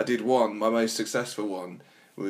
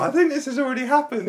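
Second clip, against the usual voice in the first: high, louder mock woman; put-on woman's voice; 6 dB louder than usual. She answers him in the hot-tub story, high put-on voice. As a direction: pitch it way up for her line. Woman's line in imagined scene.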